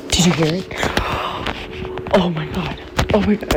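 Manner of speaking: gasp, then a scared whisper; frightened whisper. Real, not acted. Mother catching the noise on camera, gasping frightened whisper. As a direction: hush your voice, terrified it heard you.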